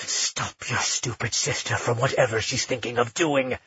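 a low, grumbling threat; growled. A narrator's reading. Kian growling at Magnus from within, low menace. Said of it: "Kian growled".

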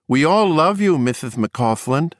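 put-on eerily sweet toothless kid; lisping boy calling.

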